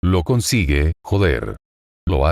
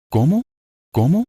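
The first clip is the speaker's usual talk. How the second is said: high, desperate cries of disbelief; screaming; 10 semitones higher than usual. A narrator's reading. Anastasia screaming at the unconscious Subaru, desperate. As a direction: fling each word up in anguish. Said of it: "gritó Anastasia".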